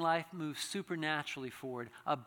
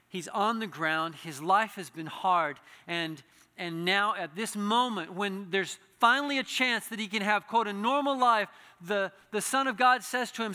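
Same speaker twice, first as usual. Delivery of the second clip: building sermon storytelling; 4 semitones higher, 9 dB louder than usual. Acted. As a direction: build the scene up toward the climax. Preacher builds the healing story.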